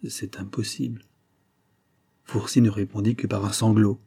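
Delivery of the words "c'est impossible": soft, stunned murmur. A narrator's reading. Dazed father hearing of his son's affair, murmuring. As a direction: murmur it well under your usual volume. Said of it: "il murmura".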